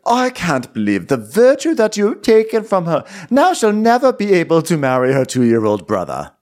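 high, hammed-up indignation; play-acted horrified father; mock royal outrage. Continues the outraged king impression.

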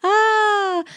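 a sudden high squeal of embarrassment; embarrassed little shriek. Real, not acted. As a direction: squeak it out, flustered. Squeal reacting to laughter.

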